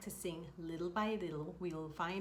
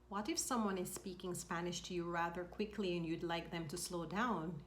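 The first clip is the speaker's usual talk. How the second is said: ordinary talk, nothing marked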